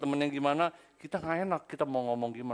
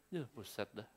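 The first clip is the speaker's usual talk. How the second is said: normal talking voice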